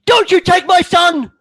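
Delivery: high, loud screaming at the soldier; a mother screaming to keep her son; furious mother. Acted. Mother to soldier taking her son, furious high shout.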